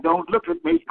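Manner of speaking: high, small voice, pretending to be scared off; mock shy voice. Hiding against the wall from a friend, high mock-shy plea. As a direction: squeak it out like you want to be left alone.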